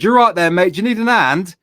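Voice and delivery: shouted offer of help; retold shout to a driver. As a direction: call out loudly to someone down the road. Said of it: "I shout out to the driver".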